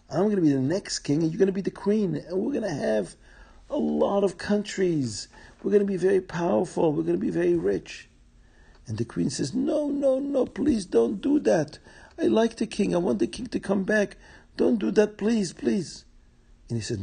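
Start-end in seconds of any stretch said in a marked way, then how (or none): 0.1-8.1 excited scheming. Acted. Viceroy boasts of his plot. 9.5-16.0 high-pitched, imploring queen; desperate pleading. Acted. Queen begs viceroy to spare king.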